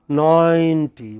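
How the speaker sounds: ninety with a stretched NINE; long first syllable. Shown as a demonstration. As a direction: hold NINE long, then add TY in ninety. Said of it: "It's a long".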